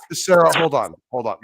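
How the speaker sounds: firm interruption, real; calling out. Real, not acted. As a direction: raise the voice to grab the floor. Cutting in on Sarah to speak.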